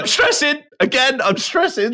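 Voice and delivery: over-the-top stressed falsetto; squeaky mock stress. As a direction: squeak it out like a frazzled colleague. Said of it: "you are so off on everything".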